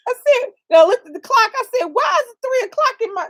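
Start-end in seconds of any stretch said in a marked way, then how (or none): 1.9-3.3 high-pitched baffled complaint; acted disbelief. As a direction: protest in mock bewilderment, voice up high. Looked at clock, retold disbelief.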